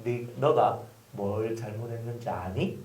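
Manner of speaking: put-on soft, unhurried question to a child; calm gentle question. Shown as a demonstration. Pastor showing parents how to question a child, soft and calm. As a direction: ask it gently, like a patient parent. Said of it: "이렇게 질문을 하면서".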